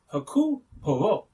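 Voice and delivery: Hercule Poirot said the French way, "air-cool pwah-roh"; French pronunciation. Shown as a demonstration. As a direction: say Hercule Poirot with a French accent, dropping the H and the final T. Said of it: "as is pronounced in the film".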